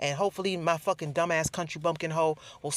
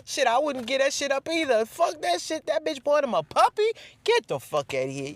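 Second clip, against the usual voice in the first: high, loud mock coworker voice; 9 semitones higher, 3 dB louder than usual. Vlogger imitating coworkers mocking the puppy gift, high. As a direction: keep clowning like the guys at work.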